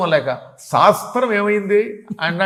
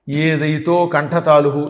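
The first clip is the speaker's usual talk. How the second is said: very slow, deliberate Sanskrit chant; recited like a grammar teacher; sutra recitation; 3 semitones lower, slower than usual. Shown as a demonstration. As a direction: spell out the rule syllable by syllable. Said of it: "కంఠము తాలువు రెండు కలిపి పనిచేస్తాయి".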